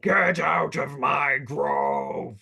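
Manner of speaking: slow, drawn-out creature roar; roaring tree monster. Acted. Tree monster warns intruders off its grove, slow roar. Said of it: "as it roars in Druidic".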